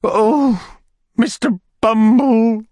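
very high falsetto lady; distressed lady's cry. A narrator's reading. Lady cries out to the beadle, high distressed voice. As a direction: wail his name, drawn out and high. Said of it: "cried the lady".

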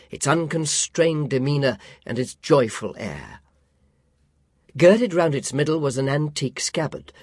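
ordinary delivery, nothing marked

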